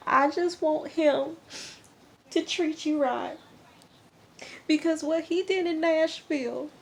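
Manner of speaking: high, teary imitation of a crying mom; a hammed-up tearful country mom; mock crying mom. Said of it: "she's crying".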